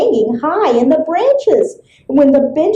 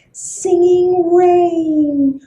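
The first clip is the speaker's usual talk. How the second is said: usual speaking voice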